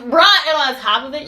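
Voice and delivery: loud, high mock indignation; incredulous outburst. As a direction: exclaim it loudly, acting appalled. Retells an incredulous comeback.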